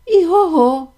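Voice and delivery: high, loud neigh; whinny it out like a horse. Acted. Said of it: "сказав кінь".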